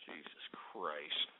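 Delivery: breathy whisper, drawn out low; fed-up whisper to himself; exasperated whisper. Real, not acted. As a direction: sigh it out under your breath. Exasperated after troll names.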